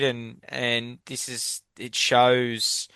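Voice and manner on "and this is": ordinary talk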